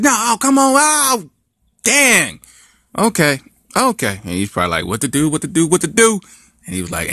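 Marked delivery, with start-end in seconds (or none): 0.0-4.2 high, loud panicked cry; high loud dismay; mock yell. Boyfriend yelling after she leaps. 4.9-6.2 rising fretful mutter; mock panic. Boyfriend panicking.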